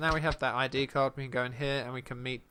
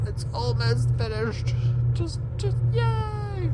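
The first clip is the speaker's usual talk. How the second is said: high, louder squeal of glee; gleeful celebration; 5 dB louder, much slower than usual. Real, not acted. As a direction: build up to a very high drawn-out yay. Celebrating a nearly finished border.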